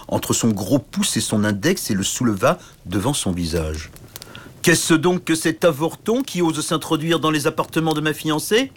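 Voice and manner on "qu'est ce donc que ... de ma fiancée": higher, mocking disdain; scornful sneer. A narrator's reading. Giant knight scorning the little knight, sneering. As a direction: look down on a tiny nuisance.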